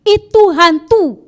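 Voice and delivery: slow, high and loud panicked cry; terrified scream. Retold or imitated. Disciples screaming in fear at Jesus on the water, panicked. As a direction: cry out in terror at a ghost. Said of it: "Yang dikatakan apa?".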